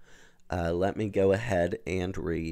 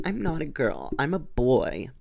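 put-on lightly pitched up like a shy boy; gentle high-pitched voice; 6 semitones higher than usual. A narrator's reading. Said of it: "in a gentle, high-pitched voice".

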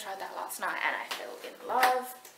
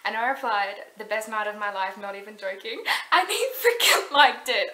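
thrilled; 13 dB louder than usual. Real, not acted. Thrilled that her reply was liked.

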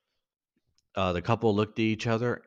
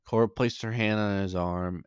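ordinary delivery

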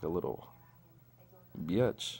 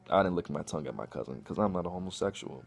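ordinary talk, nothing marked

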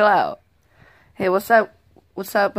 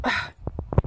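weary sigh; 6 dB quieter than usual. Acted. Giving in, exasperated.

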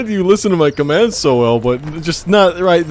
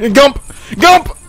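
shouted command; 8 semitones higher, 4 dB louder than usual. Real, not acted. Countdown to the jump.

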